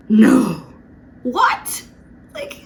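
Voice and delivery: sudden loud blurted no; startled yell of no; shocked shout. Retold or imitated. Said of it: "why did I say that".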